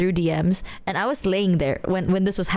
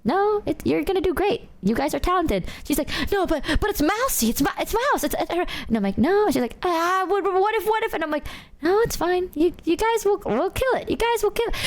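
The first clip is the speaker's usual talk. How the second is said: mock reassurance; 9 semitones higher than usual. Reassuring panicking friend.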